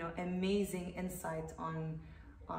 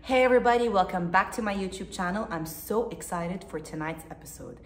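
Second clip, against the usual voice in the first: YouTube host intro; 12 dB louder than usual. Acted. Host opening a YouTube video for viewers, presenter greeting. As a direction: greet the viewers at full volume, pitched up at the start.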